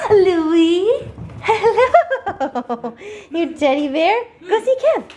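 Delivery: high cooing puppy voice. Real, not acted. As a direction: coo at the dog sweetly.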